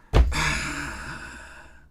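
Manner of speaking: big, loud theatrical sigh; long exaggerated sigh; mock apology sigh. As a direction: sigh heavily before a fake apology. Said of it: "make a YouTuber apology".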